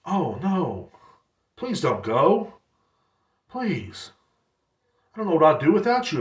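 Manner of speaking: sarcastic fake dismay; badly faked heartbreak; mock pleading. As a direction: pretend to beg, not meaning a word. Sarcastic pleading with departing customer.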